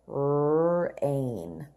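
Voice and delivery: drawn out slowly, pitched low; slow model read. Shown as a demonstration. Teacher stretching Rain out slowly for the class. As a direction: stretch the word Rain for children to copy. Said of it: "Let's read the words together".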